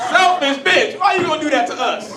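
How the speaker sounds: very loud, high indignant yell; mock outrage. Fellas complaining about a cheating girlfriend, outraged yelling. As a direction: wail the complaint in exaggerated betrayal.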